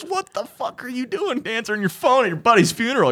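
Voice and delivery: imitating dad's outrage, high and cracking with laughter; laughing dad quote. Retelling dad's outburst on the phone, laughing through it. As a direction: play the angry dad while barely holding the laugh in.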